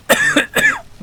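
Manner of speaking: sudden high, loud cough. Real, not acted. Player choking on their own creature voice, loud cough. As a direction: cough hard after straining the voice. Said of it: "What is that voice?".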